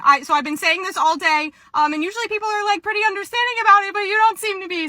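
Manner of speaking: high-pitched customer-service voice; polite pushback in a high phone voice; polite employee voice. Acted. Acts polite employee handling angry customer.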